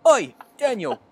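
loud hard-edged cockney call; tough cockney accent. Pretend. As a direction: bark it like a Peaky Blinders mum. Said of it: "if she came back like that".

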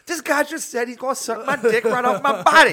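high, loud mock-outraged holler; imitating an outraged man. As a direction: holler it to a friend in mock disbelief. Man complains to his friend.

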